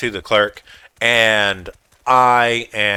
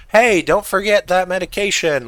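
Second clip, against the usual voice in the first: higher-pitched reenacted call across a store; 9 semitones higher than usual. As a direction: call out as if to someone far off, without a full yell. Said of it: "I call out from across the convenience store".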